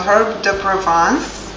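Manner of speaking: "herbe de Provence" in an amateur French accent; horrible French accent. Shown as a demonstration. Cook giving the herb's other name, rough French accent. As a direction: attempt a French accent, ending "pro-VAHNS". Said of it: "pardon my horrible French accent".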